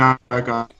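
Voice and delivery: normal talking voice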